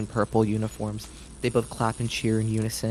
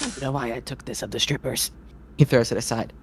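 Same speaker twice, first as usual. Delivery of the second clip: absent-minded mutter; 4 dB quieter than usual. Acted. Ringmaster fumbling.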